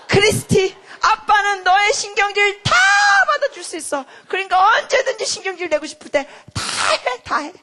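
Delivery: loud, high, hammed-up dad; father's emphatic reassurance. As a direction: act out an effusive father, building to a shout. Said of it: "표현이 조금 심하셨는데". (아버지를 흉내 낸 열렬하고 큰 목소리)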